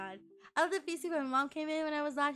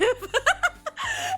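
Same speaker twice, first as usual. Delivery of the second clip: loud high burst of laughter; high cackling laugh; 7 dB louder than usual. Real, not acted. Laughing hard while retelling the aunt story.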